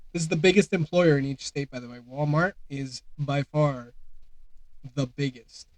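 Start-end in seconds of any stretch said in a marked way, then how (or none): none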